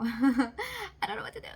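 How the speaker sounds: mock despair laughing and crying. Real, not acted. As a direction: wail it through a laugh. Repeated, laugh-cry.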